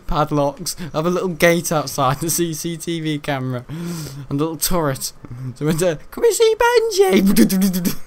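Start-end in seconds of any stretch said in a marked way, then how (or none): 6.1-8.0 very high, slow, squeaky put-on voice; mock visitor voice. A visitor at the gate asks for Benji in a squeaky put-on voice. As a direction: pipe it up like a silly caller at the gate.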